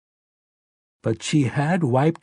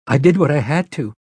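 higher, nervous pleading; a nervous young mother defending herself; 5 semitones higher, 4 dB louder than usual. Acted. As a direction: speak up anxiously, defending yourself. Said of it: "Mia said nervously".

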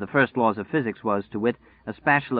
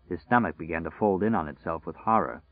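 plain reading voice

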